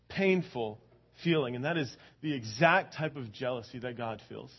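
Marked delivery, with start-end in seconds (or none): none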